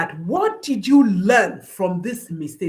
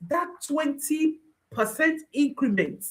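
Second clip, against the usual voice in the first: ordinary delivery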